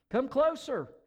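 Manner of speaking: high, loud beckoning call; inviting. A narrator's reading. Fire builder calling the woman closer, inviting. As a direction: call them in toward you. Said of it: "invited the fire builder".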